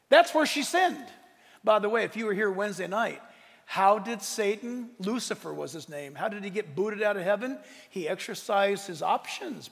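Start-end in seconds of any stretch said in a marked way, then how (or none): none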